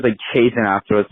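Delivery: ordinary talk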